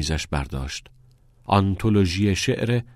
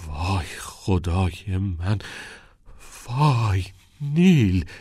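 wailing; much slower than usual. A narrator's reading. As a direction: stretch it out like a cry of shock and grief. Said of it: "شیون کنان".

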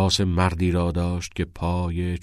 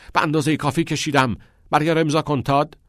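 much higher, louder, fast shout; 9 semitones higher, 5 dB louder, faster than usual. Acted. Father shouting at Todd to sign the paper, fast and loud. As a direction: yell the order at him. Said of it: "فریاد زد".

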